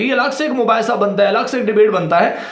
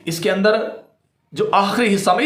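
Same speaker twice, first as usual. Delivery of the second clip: usual speaking voice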